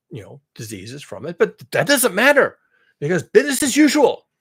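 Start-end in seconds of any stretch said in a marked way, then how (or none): 1.4-4.3 loud, raised, overplayed dismissal; mock insistence. Imagined critic dismissing health harms, mock insistence. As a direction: brush it aside with pretend conviction.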